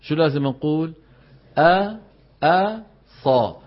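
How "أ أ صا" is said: أ sung out high and light, then صا heavy; light hamza drill. Shown as a demonstration. Teacher drilling the light hamza for students. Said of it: "لاحظوا أنني أقول أ".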